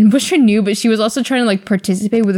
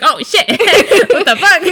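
higher, louder, laughing mock alarm; startled, laughing; 9 semitones higher, 4 dB louder than usual. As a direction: startle mid-laugh at a sudden sob. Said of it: "She was like".

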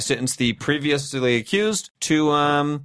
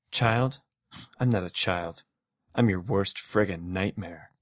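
menacing voice; 8 semitones lower, 6 dB quieter than usual. Acted. Reading a card in menace.

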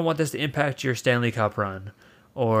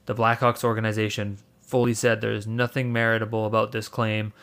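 normal talking voice

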